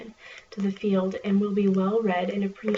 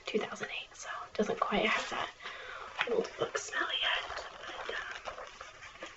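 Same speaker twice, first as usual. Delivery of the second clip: library whisper; 6 dB quieter, slower than usual. Acted.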